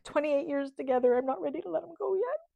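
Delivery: fighting back tears over her husband; holding back tears. Real, not acted. Wife telling viewers she won't let him go, close to crying. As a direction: voice lifts and tightens, near crying. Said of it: "I'm trying really hard not to cry".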